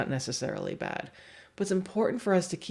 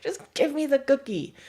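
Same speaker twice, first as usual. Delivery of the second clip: higher squeaky plea; high whiny dog voice; 9 semitones higher, 3 dB louder than usual. Retold or imitated. Trainer voicing the dog begging for a cookie in a high voice. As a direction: beg sweetly for the cookie. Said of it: "the dog is like".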